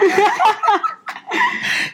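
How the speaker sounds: high burst of laughter. Real, not acted. Laughing at childhood curse.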